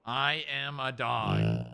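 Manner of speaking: slow, deliberate words for the dog to copy; slow model phrase. Shown as a demonstration. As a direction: spell it out slowly, word by word. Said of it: "until you say it".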